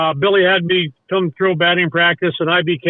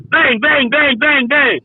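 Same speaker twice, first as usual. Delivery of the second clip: rapid-fire mimicked shots, pitched up; imitated gunshots; 5 semitones higher, slower than usual. Storyteller hearing sudden shots from the car, mimicking gunfire. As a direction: punch each word like a rifle shot.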